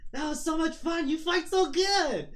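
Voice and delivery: high, loud, gushing delight; gleeful excitement. Acted. Bleeding Kenji praises the one who fought him, high and gleeful. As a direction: gush loudly, dragging out the last words.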